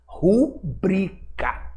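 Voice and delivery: name the word Rubrica slowly and clearly; slow spelled-out term. Shown as a demonstration. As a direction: say Rubrica slowly, each syllable drawn out. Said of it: "isso que está entre parênteses chama-se de rubrica".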